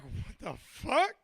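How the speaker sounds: slow, drawn-out disbelief; slowly dawning bewilderment; baffled client. Retold or imitated. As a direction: stretch it into an incredulous rising question. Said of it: "And he's like".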